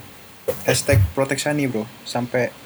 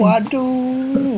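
mock surprise; 13 semitones higher, 4 dB louder than usual. Echoing mock shocked reaction.

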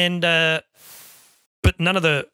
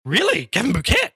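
higher-pitched, incredulous question; impressed surprise; 7 semitones higher than usual. Retold or imitated. Department head hearing who sent the newcomer, impressed surprise. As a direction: act out someone impressed by a name-drop. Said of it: "So even he was impressed".

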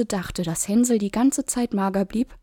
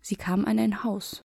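ordinary delivery, nothing marked

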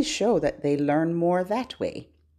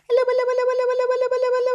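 very high, rapid-fire hellos; as fast as possible; 3 dB louder, much faster than usual. Shown as a demonstration. Librarian playing a hello game with babies, super fast. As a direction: rattle them off high without a gap. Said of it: "as fast as we can".

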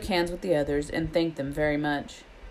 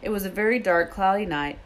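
plain reading voice

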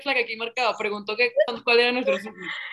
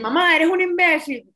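raised, drawn-out mock insult at mom; mock insolent yell; 7 semitones higher, 6 dB louder, slower than usual. Podcaster imitating people who insult their mother, mock angry yell. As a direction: play a rude kid yelling at mom, higher and slower. Voicing rude child to mother.

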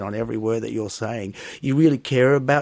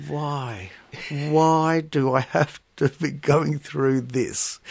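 bored audience groan; 4 semitones higher, much slower than usual. Retold or imitated. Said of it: "and you sit".

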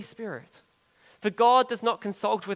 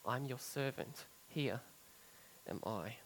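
quiet surrender; 4 semitones lower, 7 dB quieter than usual. Retold or imitated. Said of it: "It's going".

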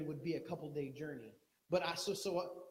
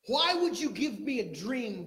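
higher, loud cry to God; bereaved mother loudly crying out to God; anguished cry; 4 semitones higher, 8 dB louder than usual. Acted. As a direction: cry out in grief.

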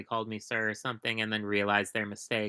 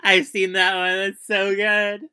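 higher, louder words through a laugh; 8 semitones higher, 7 dB louder than usual. Real, not acted. Agreeing the Vine is great, laughing through the words.

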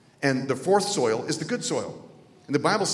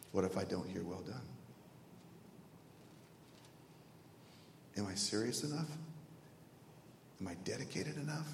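very soft, low, long pauses; hushed self-doubt; 7 semitones lower, 15 dB quieter than usual. Retold or imitated. Young man doubting he will hear 'Well done', hushed and searching. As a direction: question yourself under your breath. Retold tearful self-questioning before God.

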